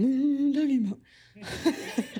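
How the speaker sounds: slow, baffled self-mock with a laugh; confused. Preacher mocking herself, baffled and laughing. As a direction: play your own confusion, drawn out. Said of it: "me getting up here and being like".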